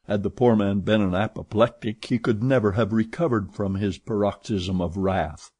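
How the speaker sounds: ordinary delivery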